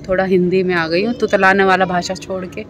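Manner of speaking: ordinary talk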